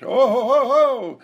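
high, loud jolly chuckle; rich man's laugh. Shown as a demonstration. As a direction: gloat like you just got rich. Acting out feeling rich.

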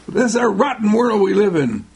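high, exaggerated bitter grumble; mock complaint. What you are saying when mad, mock bitterness. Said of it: "You're saying".

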